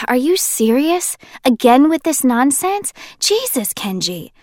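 fed up with his nonsense; irritated. Acted.

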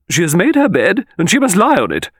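loud, high, unyielding matriarch; imperious old lady laying down the law; final Victorian pronouncement. A narrator's reading. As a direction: deliver the verdict loudly and with finality. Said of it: "this final Victorian pronouncement".

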